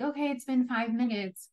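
mock alarm that five minutes have passed; surprised self. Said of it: "every time I was like".

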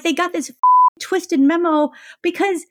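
normal talking voice

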